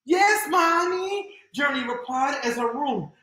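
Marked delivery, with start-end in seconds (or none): 0.0-1.4 put-on high, slow child answer; little girl voice. Journey answers her mom in a high child voice.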